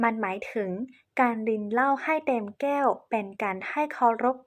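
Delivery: ordinary delivery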